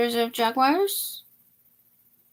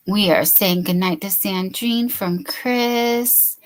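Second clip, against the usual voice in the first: ordinary talk, nothing marked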